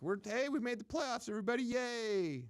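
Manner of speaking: mock a team too happy just to make the playoffs; mock Minnesota cheer. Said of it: "too much Minnesota in him".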